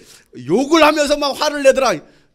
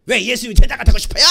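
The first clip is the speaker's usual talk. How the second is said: loud, quick mock-annoyed question; acted-out testy outburst; irritated retort; 7 dB louder, faster than usual. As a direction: snap it back, fed up. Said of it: "승질나니까".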